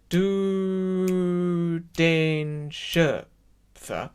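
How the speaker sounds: very long held "Du", then separated "den Schöpfer"; choral rhythm demo. Shown as a demonstration. Teacher shows what everyone else sings, drawn-out "Du". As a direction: intone "Du" on a sustained note, slowly. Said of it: "Everyone else goes".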